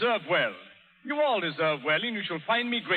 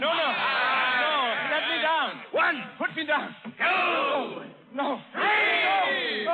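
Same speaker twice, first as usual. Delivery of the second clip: panicked protest; 5 semitones higher, much slower than usual. Acted. Colonel thrown overboard.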